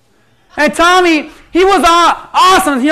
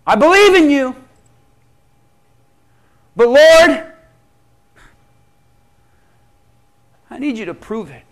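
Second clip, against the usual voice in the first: Gideon's plea; 2 semitones higher, 5 dB louder than usual. Acted. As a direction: beg honestly, then hush. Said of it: "He said".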